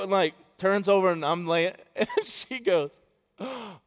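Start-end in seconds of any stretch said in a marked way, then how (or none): 3.4-3.8 gasp, then high comic wail; mock bawling. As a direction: imitate a wife bursting into sobs. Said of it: "She just bawls".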